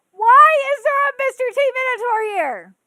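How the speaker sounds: high, loud exasperated question; exasperated outburst. Real, not acted. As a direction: demand an answer, fed up. Ranting at dub change.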